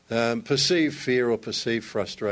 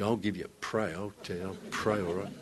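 grudging mutter; 5 semitones lower, 9 dB quieter than usual. Retold or imitated. Wife grudgingly agrees to pray.

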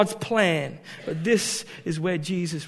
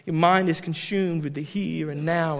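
ordinary talk

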